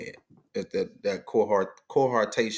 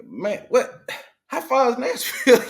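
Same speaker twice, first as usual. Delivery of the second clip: disbelieving; 14 semitones higher, 5 dB louder, slower than usual. Retold or imitated. Retold disbelief at driving.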